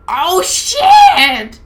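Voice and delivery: very high, loud scream; shrill scream. Acted. Barbie screaming at Potato Head's severed ear, high and loud. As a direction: shriek it in horror. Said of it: "Barbie screamed".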